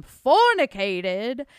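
fornicated drawn out slowly, each syllable stressed; old-timey emphasis. Acted. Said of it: "I said fornicated so weird".

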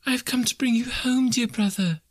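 put-on high, airy child voice; high-pitched small child; little girl. A narrator's reading. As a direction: speak as a loving little sister, high and eager. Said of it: "said the child".

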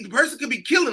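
ordinary talk

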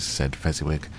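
plain reading voice

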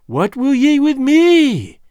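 high, slow, piping witch voice; shrill old Gagool asking the king; piping old hag. A narrator's reading. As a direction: pipe it up like an ancient crone. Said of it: "she piped".

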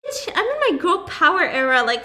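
loud, high mock battle cry; sassy egging-on. Host urging the heroine to use her power, brash and sassy. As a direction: hype her up with brash bravado. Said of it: "I'm like".

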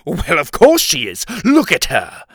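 mocking, exasperated king; sarcastic. A narrator's reading. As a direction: toss it back with heavy sarcasm. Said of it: "the king said sarcastically".

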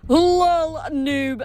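high, loud drawn-out jeer; mocking Fates. A narrator's reading. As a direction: taunt from on high, stretching it out. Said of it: "the fates up in Olympus laughing at his wishful thinking".

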